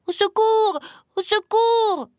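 very high cries, long on the last syllable; cry for help. A narrator's reading. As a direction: call out for rescue, high and urgent. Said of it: "cria-t-il". (cri aigu à l'aide)

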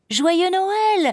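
put-on very high, cheery child's call; calling out joyfully. A narrator's reading. Boy skipping away from Emma, calling joyfully. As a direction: toss out a happy holiday wish. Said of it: "lança-t-il avant de partir".

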